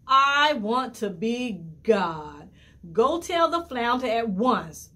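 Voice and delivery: loud, slow demand, full of rage; raging wife. A narrator's reading. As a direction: slam each word down in fury. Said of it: "The wife fell into a rage".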